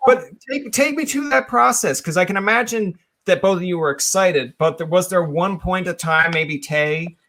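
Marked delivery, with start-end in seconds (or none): none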